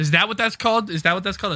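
high, loud delighted question; light up at the word; excited surprise. Real, not acted.

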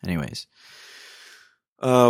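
normal talking voice

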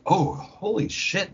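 usual speaking voice

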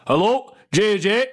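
slow, high mock-northern phone greeting; generic northern accent. Manager phoning the player, exaggerated northern accent. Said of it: "I'm doing a generic northern accent".

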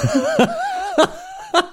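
squealing surprised laugh; high burst of laughter. Real, not acted. Caught out by Motion Array.

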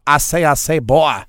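mock cartoon Southern accent; Foghorn Leghorn. Mocking an actor's bad accent, loud cartoon Southern. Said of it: "sounds like Foghorn Leghorn".

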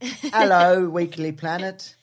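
slow, exaggerated Cockney greeting. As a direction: ham up a Cockney accent. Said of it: "It could be Cockney".

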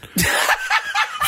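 sudden high-pitched burst of laughter; high giggling laugh breaking through. Real, not acted. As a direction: crack up in a high squeal of laughter. Laughing burst mid-story.

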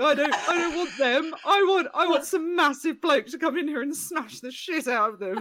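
high-pitched comic pleading; mock desperate protest. Comedian retelling her plea against rowdy lads, mock desperate.

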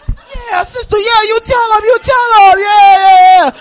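high cheer building to a shout; cheering crowd. Acted. Balcony crowd clapping for the student, building high cheer. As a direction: egg her on, rising in volume.

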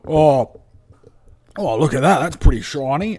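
loud put-on character voice; loud, groggy fascination; Tibbs's dazed wonder. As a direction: play a sick man distracted by something shiny. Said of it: "and he's like".

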